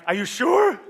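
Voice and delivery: high, loud disbelief; incredulous retold question. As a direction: shoot the pitch up in amazement. Husband stunned by wife's news.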